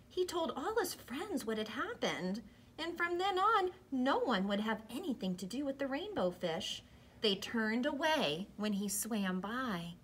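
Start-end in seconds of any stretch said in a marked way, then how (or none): none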